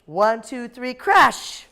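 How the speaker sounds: countdown rising to a loud crash; excited countdown. Real, not acted. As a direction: count them in and shout crash. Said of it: "countdown for car crash game".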